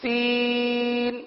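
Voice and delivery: سین stretched very slowly for the drill; drawn-out letter name. Shown as a demonstration. Teacher spelling the letters for the class, slow. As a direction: draw out سین before the vowel mark. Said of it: "پیش".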